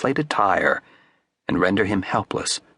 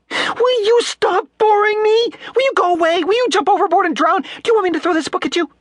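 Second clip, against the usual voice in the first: high, loud exasperated outburst; shrill, high fury; furious girl; 6 dB louder than usual. A narrator's reading. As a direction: fling the questions at him.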